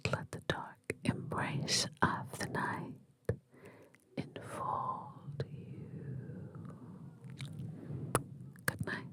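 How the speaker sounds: slow, fading whisper to send them to sleep; soft goodnight whisper; sleepy bedtime whisper. Acted. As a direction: draw out the last words as the listener drifts off.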